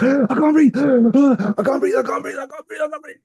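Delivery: high, breathless gasping panic; panic attack gasps. Retold or imitated. Retelling his panic under hypnosis to a friend, high gasping. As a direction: gasp out each word as if choking.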